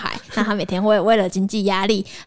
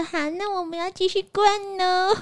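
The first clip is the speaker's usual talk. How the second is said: put-on high, soft cutesy baby voice; 10 semitones higher, 4 dB quieter than usual. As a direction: coo it like a little doll. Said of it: "用很恶心的娃娃音说".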